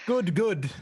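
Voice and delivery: put-on player echoing the captain's Canadian accent; a light mock Canadian good good; teasing Canadian echo; imitating Canadian. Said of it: "he's Canadian".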